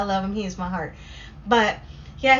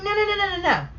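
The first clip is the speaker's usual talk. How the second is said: high, rapid string of refusals; flustered, high-pitched protest; mock panic; 10 semitones higher, much faster than usual. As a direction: play up a retold panic. Retold panicked refusal of the box.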